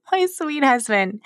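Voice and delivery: high, loud, laughing aside; amused aside. Real, not acted. Wry aside while retelling her blaming.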